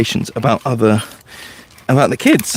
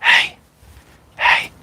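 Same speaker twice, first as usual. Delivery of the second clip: hushed, voiceless whispering; retold whisper; 3 dB quieter, slower than usual. Leader whispering to a boy a hundred foot away, whispered. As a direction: whisper it as if to someone far off. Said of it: "I would whisper things and go".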